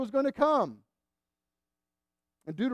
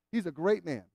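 ordinary talk

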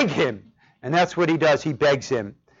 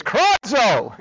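higher, exclaimed KRADZO; onomatopoetic cry; 5 semitones higher than usual. Shown as a demonstration. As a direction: cry out the word like its meaning. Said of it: "It sounds like what it is".

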